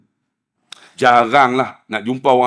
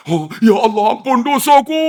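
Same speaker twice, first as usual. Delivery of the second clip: high, rising mock wail; tearful wailing prayer; 7 semitones higher than usual. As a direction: imitate a man crying out to God. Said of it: "Menangis dia".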